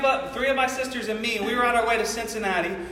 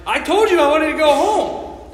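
put-on higher, louder, slower kid's yell; bratty child yelling at step-grandpa; defiant yell; 6 semitones higher, 8 dB louder than usual. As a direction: shout it back while running away. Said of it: "and I was like".

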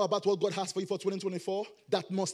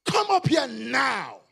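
slow, loud shouted summons; exclaimed command; 6 dB louder, slower than usual. Acted. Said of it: "this should carry an exclamation".

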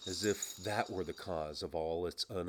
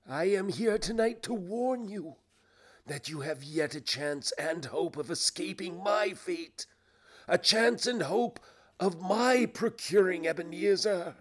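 higher, earnest ghostly warning; solemn ghost; 10 semitones higher, 6 dB louder than usual. Acted. Ghost offers Scrooge a chance of escape, warning. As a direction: warn him urgently, swelling at the end.

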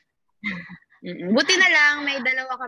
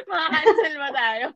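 laughing; 4 semitones higher than usual. Real, not acted. Joking about being cancelled.

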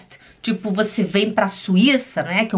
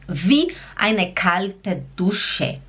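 very slow model phrase; devagar e claro; 2 semitones lower, much slower than usual. Shown as a demonstration. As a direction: lay it out slowly, word by word. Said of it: "A próxima palavra seria". (expressão nova, bem devagar)

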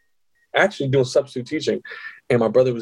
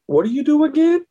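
high-pitched, astonished asking; incredulous question; 14 semitones higher than usual. Acted. Man seeing friend's big paycheck, incredulous high question. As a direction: let the pitch leap in disbelief. Acted disbelief at her pay stub.

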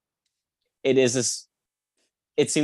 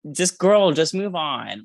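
exasperated; 6 semitones higher, slower than usual. Real, not acted.

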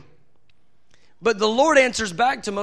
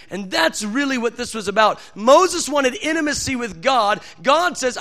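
ordinary delivery

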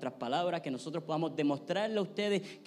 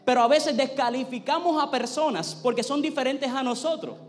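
higher, louder sermon voice, driving each phrase; rising sermon fire; full-force preaching; 7 semitones higher, 6 dB louder than usual. Acted. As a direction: preach it out to the congregation with conviction. Preaches the point loudly.

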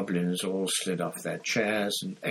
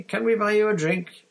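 high, loud, chirpy question; perky and raised in pitch; bright offer; 11 semitones higher, 7 dB louder than usual. A narrator's reading. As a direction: pipe up eagerly with an offer. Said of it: "said Fred brightly".

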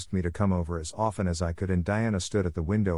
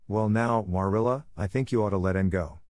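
usual speaking voice

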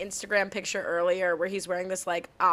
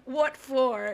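lightly drawled Southern what for; a hint of Southern drawl; playful Southern accent; mock Southern accent; 2 semitones higher than usual. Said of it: "that was my Southern accent".

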